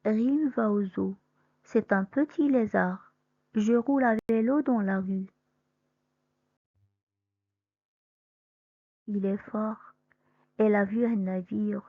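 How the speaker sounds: clear, careful sentences for pupils to copy; teacher modelling sentences; model sentence reading. Shown as a demonstration. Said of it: "comment je vais lire les phrases".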